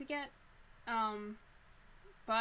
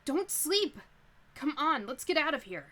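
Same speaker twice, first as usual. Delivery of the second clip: urgent shout; 7 semitones higher, 13 dB louder than usual. Acted. Shaking awake a sleeping companion.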